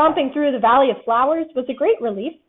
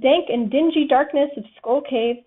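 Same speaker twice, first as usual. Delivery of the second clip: usual speaking voice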